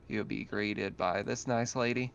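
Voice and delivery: ordinary talk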